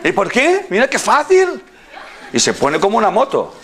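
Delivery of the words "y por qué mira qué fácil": higher, laughing mock woman's voice; imitating a woman. Retelling the woman's easy solution, laughing imitation. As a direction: play her delight, giggling.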